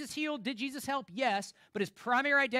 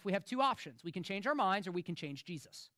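normal talking voice

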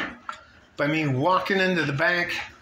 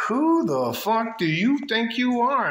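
imitating her, higher and drawn out; slow, higher mock-outraged demand; retold indignant challenge; 6 semitones higher than usual. Woman confronting him at the bank, retold indignant challenge.

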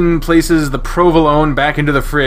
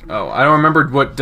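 normal talking voice

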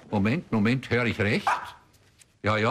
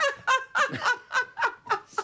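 high, rolling laugh; long laugh. Real, not acted. Husband laughing at his own cucumber joke, long high laugh. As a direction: crack up at your own joke.

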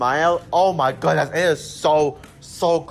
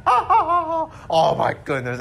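laughing; 13 semitones higher, much slower than usual. Real, not acted. Laughing at his own impression.

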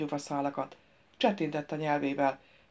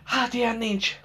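higher, exasperated disbelief; through gritted teeth; 6 semitones higher, 3 dB louder than usual. A narrator's reading. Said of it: "fogcsikorogta No Gentle".

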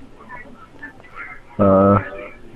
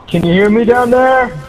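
calling down from tower; 10 semitones higher than usual. Real, not acted. Calling down to friend below.

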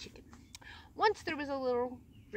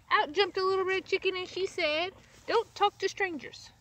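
higher and louder, eager; eager interrupting chick; excited interruption; 7 semitones higher, 5 dB louder than usual. Acted. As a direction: jump in eagerly to warn her.

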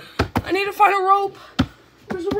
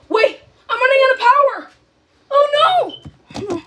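high, loud, rushed alarm; panicked shout; 5 semitones higher, 4 dB louder than usual. Real, not acted. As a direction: shout as disaster hits. Power running out in game.

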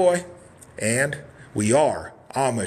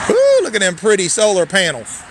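louder, raised hype call; excited hype; 5 semitones higher, 8 dB louder than usual. Real, not acted. Host showing off the solar panels, loud excited hype. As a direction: sell it loud.

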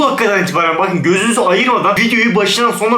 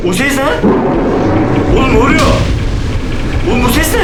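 startled, pitched-up cries of alarm; scared; 7 semitones higher than usual. Real, not acted. Gamer spooked by creepy noises, startled alarmed cries. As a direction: yelp it out, spooked by sounds.

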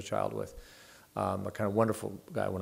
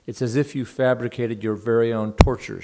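exclaimed; 2 semitones higher, 10 dB louder than usual. Retold or imitated. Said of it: "she exclaimed".

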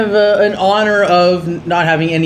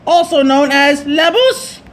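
much higher, laughing mock pirate; high silly villain voice; hammed-up pirate captain; 8 semitones higher than usual. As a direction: proclaim it like a pompous pirate. Voicing pirate captain dialogue.

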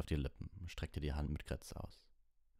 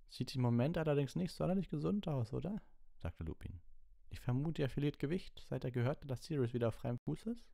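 higher, gentle teacher voice; Lupin; 11 semitones higher than usual. Acted. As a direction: ask it like a patient teacher.

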